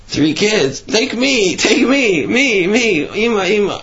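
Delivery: put-on high pleading cries of children. Children on the train begging their mother, crying.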